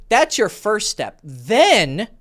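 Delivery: usual speaking voice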